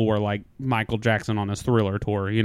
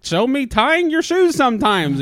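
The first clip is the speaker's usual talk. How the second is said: high, booming game-show host impression; Steve Harvey; 13 semitones higher, 4 dB louder than usual. Host calling a silly answer to the board, loud mock disbelief. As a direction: call the answer to the board, hammed up.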